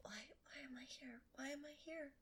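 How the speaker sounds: whispered why am I here; despairing whisper. Real, not acted. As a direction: breathe it out in despair. Host despairs at co-hosts.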